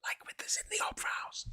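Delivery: sudden whisper; whispered aside. Retold or imitated. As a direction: drop to a whisper mid-rant.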